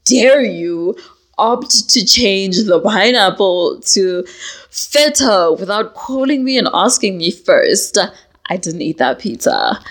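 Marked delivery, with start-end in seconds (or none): 0.1-8.0 slow, drawn-out offended how dare you; mock outrage. Scolding her partner over feta pizza, slow mock outrage. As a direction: deliver the accusation slowly in hammed-up hurt. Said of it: "I am beyond hurt".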